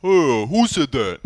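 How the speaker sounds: slow, loud, hammed-up ogre voice; cartoon brute. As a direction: bellow it like a dim brute ogre. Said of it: "I can do the deep ogre voice".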